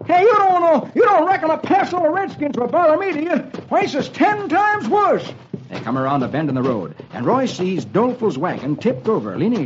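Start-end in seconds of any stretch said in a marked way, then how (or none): 0.1-5.3 very high, worked-up character voice; agitated Dolfo. Acted. Dolfo telling Roy of his trouble, agitated high voice. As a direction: protest in a high, excitable old-timer voice.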